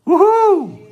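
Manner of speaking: high, loud whoop of joy; whooping cheer. Pretend. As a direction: let out a joyful whoop. Cheer as the giver. (grito de alegria agudo)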